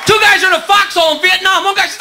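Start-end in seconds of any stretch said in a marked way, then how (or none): none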